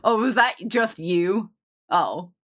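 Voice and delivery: ordinary talk, nothing marked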